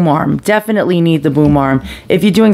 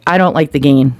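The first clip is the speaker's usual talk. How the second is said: normal talking voice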